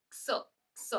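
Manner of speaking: unvoiced क्स क्स; whispered phonic क्स; ks sound. Shown as a demonstration. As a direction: hiss the क्स sound twice. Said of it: "टू phonic sounds".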